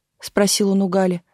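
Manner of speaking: plain reading voice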